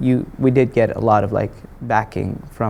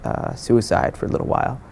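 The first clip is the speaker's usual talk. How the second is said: ordinary talk, nothing marked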